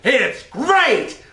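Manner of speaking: hammed-up excited rave; over-the-top enthusiasm. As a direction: gush like an overexcited kid. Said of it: "You show a little enthusiasm".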